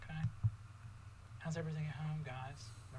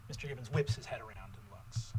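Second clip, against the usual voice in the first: usual speaking voice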